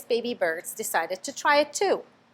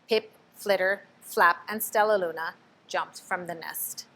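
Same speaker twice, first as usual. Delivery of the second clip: ordinary delivery, nothing marked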